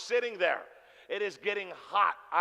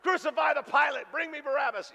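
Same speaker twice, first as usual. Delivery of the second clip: mock rant; 7 semitones higher, 4 dB louder than usual. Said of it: "just going on and on".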